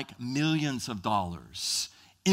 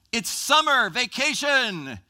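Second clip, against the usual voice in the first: loud, high, drawn-out exclamation; mock excited cheer; 8 semitones higher, 7 dB louder, slower than usual. Preacher mimics glee at summer break, excited shout. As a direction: whoop it slowly like school is out.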